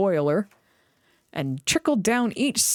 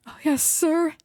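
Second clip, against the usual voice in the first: nervous; 8 semitones higher than usual. A narrator's reading. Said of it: "said Thomas nervously".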